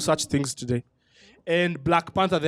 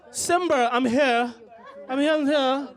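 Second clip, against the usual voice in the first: put-on American accent; 6 semitones higher, slower than usual. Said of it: "the lions got American accents".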